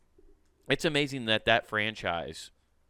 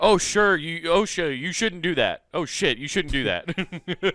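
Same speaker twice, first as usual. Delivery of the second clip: higher, hammed-up telling-off; mock scolding; 5 semitones higher, 4 dB louder than usual. Co-host guessing what osha is short for, mock scolding. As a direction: chide in a raised joke voice, breaking into laughter.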